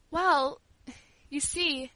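halting start with pauses; hesitant, trembling. A narrator's reading. Girl explains her request to the servant, hesitantly. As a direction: begin timidly, feeling for the words. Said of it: "said hesitatingly in a trembling voice".

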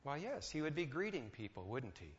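normal talking voice

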